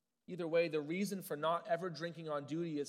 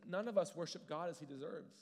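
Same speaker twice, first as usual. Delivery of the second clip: usual speaking voice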